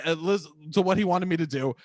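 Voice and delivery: ordinary delivery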